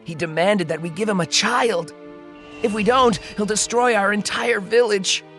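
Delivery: understated tearful account; tearful villager. Acted. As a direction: tell it with a touch of grief, breaking down at the end. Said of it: "through his tears".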